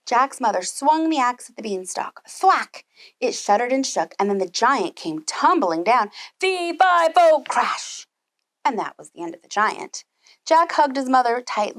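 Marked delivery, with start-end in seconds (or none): 2.3-2.8 sharp thwack. A narrator's reading. Axe hits beanstalk. 6.4-8.1 giant voice. Acted. Giant falling.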